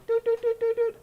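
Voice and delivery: mimicked console boops, high and loud; high-pitched mock beeping; high electronic beeps. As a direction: go doot-doot like a control panel. Imitates button beeps.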